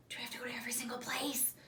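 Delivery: hushed, quick whisper; fast, breathless whisper of complaint. A narrator's reading. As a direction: whisper it so only Mom hears. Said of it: "I whisper to Mom".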